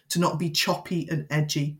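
ordinary talk

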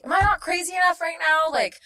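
high, loud, exasperated begging; frantic plea. Retold or imitated. Traveler begging airport guards to search her, frantic. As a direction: escalate into a desperate retold rant.

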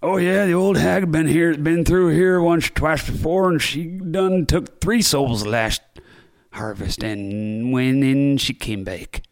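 put-on slow, drawling old country voice; old pub local. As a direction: spin a spooky tale like an old villager. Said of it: "and they'd be like".

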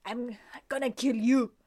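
slow, put-on French accent. Retelling the prince's threat to Chloe, attempted French accent. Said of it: "That was my attempt at a French accent".

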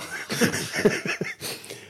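airy high-pitched giggle; a squeaky, silent-ish laugh; high breathy laugh. Real, not acted. Laugh alone.

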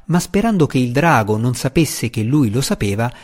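usual speaking voice

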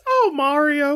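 very high, exasperated sigh of a princess; sighing Princess Peach. Retold or imitated. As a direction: sigh his name, fed up. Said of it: "sighs and says".

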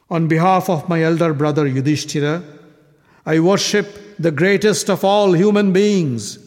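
solemn then daring challenge; defiant warrior. Acted. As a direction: dare anyone to step forward. Sahadeva challenges the kings.

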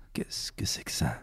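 soft whispered question. Acted. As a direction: whisper it like a mystery.

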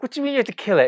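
high, loud appalled cry; aghast shout; horrified. Retold or imitated. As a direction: demand it in disbelief. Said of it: "I was like".

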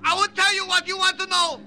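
high, loud panicked begging; terrified pleading. Acted. Captured colonel begs to be spared from Ying, panicked high loud pleading. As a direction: shout out your surrender before the torturer reaches you.

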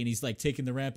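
ordinary talk, nothing marked

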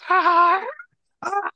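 imitating Nick Cage's scream. Mocking Nick Cage's screams to cohosts, high loud mock yelp. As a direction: yelp it out like a possessed Nick Cage. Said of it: "all of his screams and his yells".

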